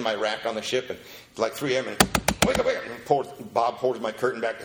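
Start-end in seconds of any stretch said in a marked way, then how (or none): 2.4-2.8 urgent wake-up call. Retold or imitated. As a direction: shake him awake with urgency. Sponsor waking him at 3am.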